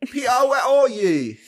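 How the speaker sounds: high, hammed-up British calling; British accent. Co-host playing the searching girl in mock British accent. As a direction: call out in a silly British voice. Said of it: "British accent".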